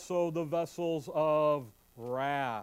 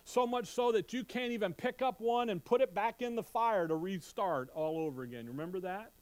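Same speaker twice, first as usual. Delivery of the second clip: normal talking voice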